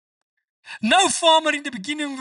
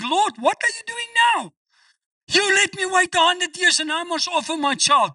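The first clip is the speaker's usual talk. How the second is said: incredulous protest; 8 semitones higher than usual. Acted. Abraham protests God's command.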